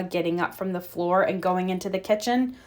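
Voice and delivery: plain reading voice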